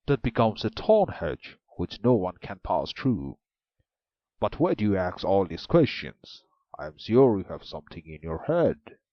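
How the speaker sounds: loud, suspicious ogre voice; the ogre grows wary; an ogre questions the maiden, loud and wary. A narrator's reading. Ogre's voice.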